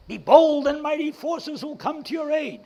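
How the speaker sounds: bold rallying cry. Retold or imitated. Rousing himself to go back, a loud high rallying cry. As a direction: belt it out like a battle cry. Shouting his motto of persistence.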